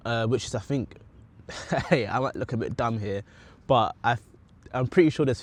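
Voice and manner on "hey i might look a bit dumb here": ordinary talk, nothing marked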